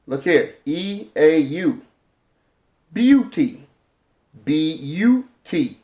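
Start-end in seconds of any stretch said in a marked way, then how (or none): 2.9-3.6 "beauty" high, with a clear byoo glide; triphthong demo. Shown as a demonstration. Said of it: "that's a triphthong". 4.4-5.8 "B U T" slowly as bee, you, tee; sounded out. Shown as a demonstration. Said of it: "that's a triphthong".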